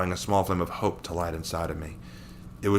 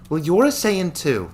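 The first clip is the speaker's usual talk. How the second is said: put-on a girl's voice, much higher, slow and earnest; 10 semitones higher, 6 dB louder than usual. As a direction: remind him gently but firmly. Victoria reassures the hero.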